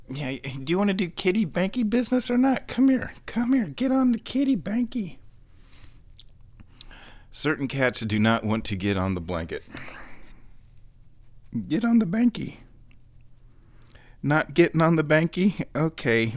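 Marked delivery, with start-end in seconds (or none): 0.1-5.2 fast, very high pet voice; talking to the cat. Real, not acted. Calling the cat onto blanket. 11.7-15.6 fast, very high pet voice; talking to the cat. Real, not acted. Calling the cat onto blanket.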